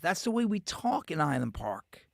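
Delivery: normal talking voice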